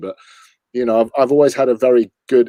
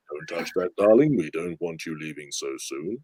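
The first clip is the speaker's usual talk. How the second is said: lower, softer suave Bond voice; much softer Bond charm; Roger Moore; 7 semitones lower, 6 dB quieter than usual. Acted. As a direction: purr it like a smooth Bond. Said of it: "launched into Roger Moore".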